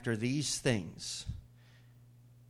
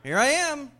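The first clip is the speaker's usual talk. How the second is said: high, loud ready answer; calling back; 13 semitones higher, 9 dB louder than usual. Acted. Abraham responds immediately to God, calling back loudly. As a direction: sing out the reply at once.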